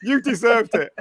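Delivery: high-pitched, through laughter; amused comeback breaking into laughter; laughing retort. Real, not acted. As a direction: laugh as you insist on it. Laughing retort ending the argument.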